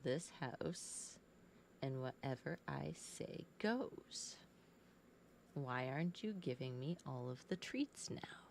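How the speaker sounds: a cat ending in a whisper; cat's voice. Pretend. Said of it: "You're looking at me like".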